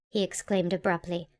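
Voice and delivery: plain reading voice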